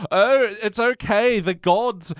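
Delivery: put-on high, halting old man's voice; senile old maester. Maester approving the marriage before the court, senile old man. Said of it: "then senile old maester comes along".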